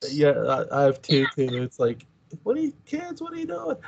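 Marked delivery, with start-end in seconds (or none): 2.4-3.8 high, soft put-on parent voice; play-scold the kids in a small high voice; mock exasperated parent. Host imitates talking to the two kids, mock exasperation.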